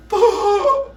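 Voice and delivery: loud high gasp. Retold or imitated. Pupil out of air, loud high gasp. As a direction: gasp like a suffocating child.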